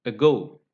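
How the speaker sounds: ago with weak a, stressed GO; accented on the root. Shown as a demonstration. Teacher lists weak-prefix words, ago stressed on GO. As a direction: lean on go in ago. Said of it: "accented on the root".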